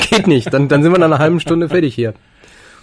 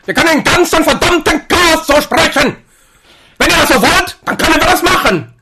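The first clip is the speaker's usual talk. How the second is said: high, loud ranting dictator; Hitler impression; 13 semitones higher, 7 dB louder than usual. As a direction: shout it like a parody dictator. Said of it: "den Hitler machen".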